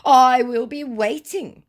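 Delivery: high, loud, slow mock pomposity; self-righteous. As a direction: draw it out like a self-righteous scammer. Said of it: "Again with its self-righteousness".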